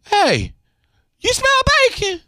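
high, excited neighbor sniffing the air; I smell bacon voice. Acted. Host does his neighbor catching the bacon smell, high and excited. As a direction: squeal it like the smell just hit you. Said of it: "That's my I smell bacon voice".

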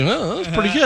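high-pitched mock wife; imitating wife. Husband quoting his wife after a movie, imitating her. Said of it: "she was like".

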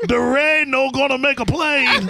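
slow mock Spanish accent; Cuban accent. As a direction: drag it out in a put-on accent. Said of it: "Why does it sound Mexican?".

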